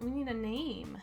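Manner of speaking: ordinary talk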